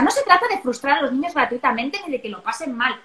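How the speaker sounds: ordinary delivery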